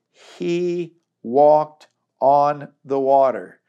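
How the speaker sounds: slow, weighty scripture read. Acted. Preacher stretches out the miracle for the congregation, slow and weighty. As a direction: let each word land slowly.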